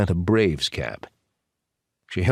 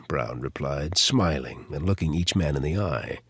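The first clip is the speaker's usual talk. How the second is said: ordinary delivery, nothing marked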